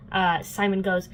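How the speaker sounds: ordinary talk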